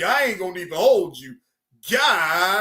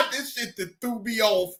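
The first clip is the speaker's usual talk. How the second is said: laughing; 3 semitones higher than usual. Real, not acted. Laughing at the situation.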